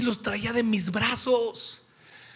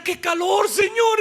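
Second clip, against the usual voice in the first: much higher, loud whiny cry to the Lord; mock complaining shout; 12 semitones higher, 7 dB louder than usual. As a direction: ham up the people's complaint. Imita al pueblo quejándose. (queja exagerada a gritos)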